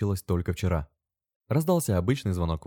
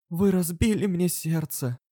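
high, drawn-out lament; slow, high wail of reproach; heartbroken exclamation; 11 semitones higher, slower than usual. A narrator's reading. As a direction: cry it out as if heartbroken. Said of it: "воскликнула она".

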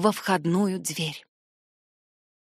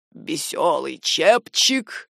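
low, slow, heavy voice; grim lord; 5 semitones lower, much slower than usual. A narrator's reading. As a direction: deliver the bad news gravely. Lord breaks grim news of shipwreck. (низкий мрачный голос лорда)

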